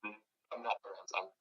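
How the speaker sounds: bad phone line dropping out; call breaking up. Real, not acted. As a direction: voice cutting in and out. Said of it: "it sounds like you're still breaking up".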